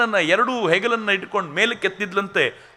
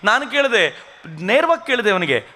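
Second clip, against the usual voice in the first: usual speaking voice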